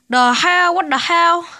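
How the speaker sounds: high, loud, exasperated disbelief; incredulous outburst. Real, not acted. Vlogger baffled by slang in a book, loudly incredulous.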